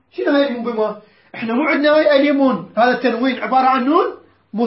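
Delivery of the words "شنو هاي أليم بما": ordinary delivery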